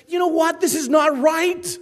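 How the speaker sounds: high, loud indignant cry as God; God's indignant shout. Acted. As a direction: shout the divine objection. God voiced condemning nations.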